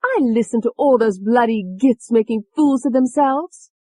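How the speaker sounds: high, loud, contemptuous sneer; Tony's voice. A narrator's reading. Tony scorning the doctors, mocking. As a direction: spit it out like a rough cockney girl. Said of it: "She mimicked one of their voices".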